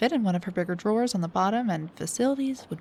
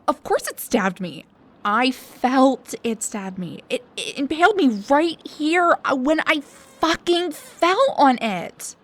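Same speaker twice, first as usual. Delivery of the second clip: upset insistence; 5 semitones higher, 6 dB louder than usual. Acted. Insists the arrow stabbed her.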